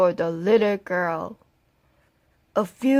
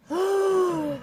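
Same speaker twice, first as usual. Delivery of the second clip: a startled high gasp; sharp gasp; 9 semitones higher, 3 dB quieter than usual. Acted. Woodsman spotting the sleeping wolf, sharp gasp. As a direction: gasp at the sight.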